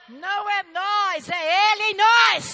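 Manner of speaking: high, slow, full-voiced preaching shout; shouted proclamation. Real, not acted. Preacher credits God for the healing, shouted proclamation. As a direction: bellow it out, stretching each phrase.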